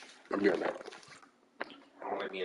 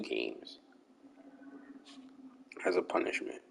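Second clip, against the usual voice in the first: whispering; 12 semitones lower, 15 dB quieter than usual. Real, not acted. Quiet aside after losing.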